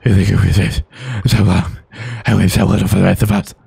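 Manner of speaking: deep, muffled growl, hard to understand; mumbled gruff voice. Pretend. The cowboy hero taunts his rival in a deep garbled growl. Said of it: "I can't even understand you".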